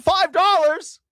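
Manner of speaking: very high gasping squeal; mock amazement. Mimicking happy drivers at gas prices, squealed amazement. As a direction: gasp and squeak it in fake awe.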